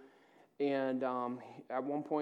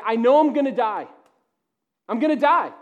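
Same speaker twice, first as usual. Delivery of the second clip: loud, higher panicked quote of Jonah; desperate cry; 7 semitones higher, 13 dB louder than usual. Retold or imitated. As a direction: cry it out like a drowning man. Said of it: "he's like".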